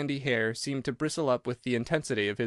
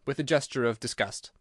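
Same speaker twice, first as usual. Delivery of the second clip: ordinary delivery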